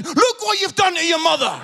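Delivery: high-pitched, loud dad yell; re-enacted parental bellow, building on the repeat; angry shouting. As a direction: shout an outraged scolding at your son. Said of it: "shouting at Jordan".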